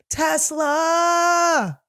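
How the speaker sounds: high, drawn-out yelled "Tesla!"; comic shouted goal at the big moment; mock climax yell. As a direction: call out like Stanley yelling for Stella. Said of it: "You're like".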